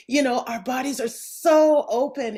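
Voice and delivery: ordinary delivery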